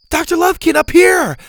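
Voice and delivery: high, loud hail from above; urgent high-pitched shout. Acted. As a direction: yell to get someone's attention. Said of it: "they shouted".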